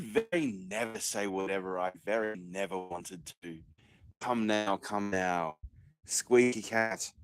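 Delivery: a hammed-up lord proclaiming freedom; low pompous orator voice. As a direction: declaim it like a self-important noble. Pompous mock speech.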